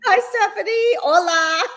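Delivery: bright high hello to a viewer; excited greeting. Real, not acted. Host greeting a viewer in the live chat, excited. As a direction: call out her name with delight.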